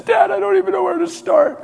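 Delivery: high, tearful wail of a son; acted sobbing, very high voice; imitated bawling. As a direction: cry it out to your father. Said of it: "He's just bawling".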